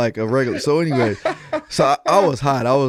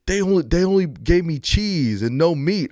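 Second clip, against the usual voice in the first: put-on complaining kid; 6 semitones higher than usual. Son complaining about burger.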